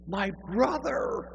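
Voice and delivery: mock exuberant cry, high, loud and drawn out; effusive greeting. Man in three-piece suit greets a stranger at church, effusively. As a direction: belt out a long, overjoyed welcome.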